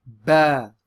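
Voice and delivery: Ba said loud and clear as a teacher model; teacher modelling Ba; Arabic letter Ba. Shown as a demonstration. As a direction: model Ba for students to repeat. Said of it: "And one more time".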